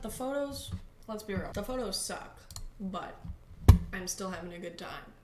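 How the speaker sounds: usual speaking voice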